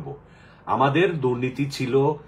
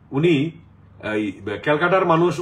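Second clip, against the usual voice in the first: ordinary talk, nothing marked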